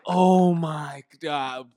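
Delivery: loud, long drawn-out disbelief; retold shock. Acting out the shock of reveal.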